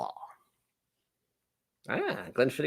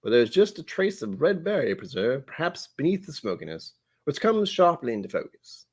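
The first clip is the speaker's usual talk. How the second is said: measured English butler reading tasting notes; English butler accent; 2 semitones higher than usual. Acted. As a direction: read it out in a put-on English accent. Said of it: "I slipped into an accent by accident".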